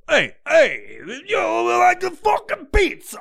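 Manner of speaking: high, shouted New York Guido caricature; mock Guido accent. Mocking New Yorkers pushing pizza, shrieked Guido accent. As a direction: holler it like a cartoon Guido. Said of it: "the people that go".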